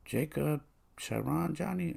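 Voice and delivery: high, incredulous run through the names; can't believe he's hearing that name; stunned disbelief. Retold or imitated. Grandfather hearing his long-lost grandson is coming, stunned high disbelief.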